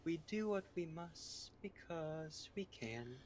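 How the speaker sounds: slow robotic AI voice; lightly voiced deadpan computer; male GLaDOS. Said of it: "glados impression".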